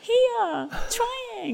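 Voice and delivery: very high, squeaky little voice; comic squeak reply. Retold or imitated. Answering people who ask where she had been, in a very high comic squeak. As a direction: squeak it out like a tiny meek mouse. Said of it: "I go".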